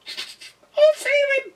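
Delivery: very high, very slow squeak; squeaky squirrel. A narrator's reading. Squirrel offering to rescue the duck, squeaking. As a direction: squeak bravely like a tiny squirrel. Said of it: "squeaked the squirrel".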